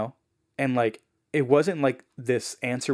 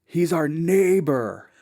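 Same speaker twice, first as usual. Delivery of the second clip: slow, higher, louder announcement; amazed reveal; 6 semitones higher, 6 dB louder, much slower than usual. Retold or imitated. Zach pointing at the neighbor for the family, amazed and slow. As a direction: draw it out as an amazing reveal. Teen points out amazing link.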